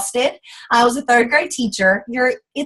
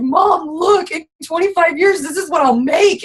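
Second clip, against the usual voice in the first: high, crying half-shout at Mom; retold tearful meltdown to her mother; crying freak-out; 7 semitones higher than usual. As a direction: sob it out in a panic. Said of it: "And I'm freaking out".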